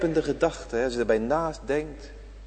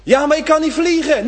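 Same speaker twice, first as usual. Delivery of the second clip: high, loud protesting voice; imagined churchgoer protesting to the preacher, high and loud; objecting listener; 12 semitones higher, 10 dB louder than usual. Acted. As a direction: whine back like a doubter.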